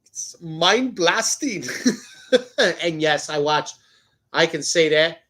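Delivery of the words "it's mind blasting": put-on slow, drawn-out Indian accent, pitch lifted; imitated Indian accent. Quoting Indian friends' catchphrase, slow imitated Indian accent. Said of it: "To coin, uh, my, my Indian friends".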